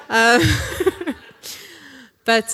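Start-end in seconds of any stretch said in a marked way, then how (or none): 0.4-1.1 high, breathy laugh. Real, not acted. Preacher giggling at her own bad joke to the congregation. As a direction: giggle helplessly.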